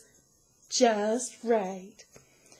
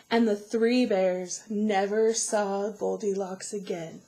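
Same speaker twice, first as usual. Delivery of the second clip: plain reading voice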